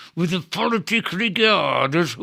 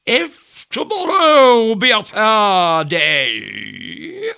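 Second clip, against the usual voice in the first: mimicking Ajax; 3 semitones higher, 6 dB louder than usual. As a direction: drag each word out like a self-important dullard. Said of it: "I'll put on his presence".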